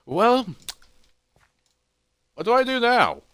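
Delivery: high, loud, exaggerated wondering; hammed-up bewilderment; puzzled hero. Hero at a loss in story.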